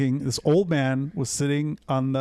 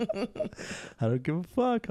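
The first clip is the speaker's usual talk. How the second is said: laughing defiance; 7 semitones higher, 5 dB quieter, much slower than usual. Real, not acted. Laughing defiant snitch boast.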